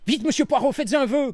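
high, loud excited call; eager cry. Acted. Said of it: "cria Bridget". (cri pressé et aigu)

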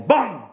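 loud, sudden "bam"; gunshot imitation. A narrator's reading. Narrator voicing the shot in the woods, gunshot imitation. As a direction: burst it out like a pistol shot. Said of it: "un coup de pistolet".

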